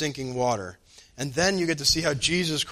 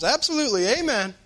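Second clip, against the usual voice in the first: higher, louder cheerful amen; joyful amen; 11 semitones higher, 7 dB louder than usual. Retold or imitated. Answering about plumbing problems, joyfully upbeat. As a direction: ring it out happily.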